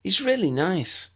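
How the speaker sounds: put-on high, fast little girl; bright eager child asking; young girl's voice. A narrator's reading. Lydia speaking.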